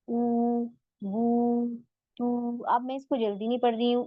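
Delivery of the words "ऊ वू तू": ऊ, वू, तू each held long; slow madd recitation of ऊ वू तू; drawn vowels. Shown as a demonstration. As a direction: stretch every ऊ sound two counts. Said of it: "उल्टा पेश में भी वही है".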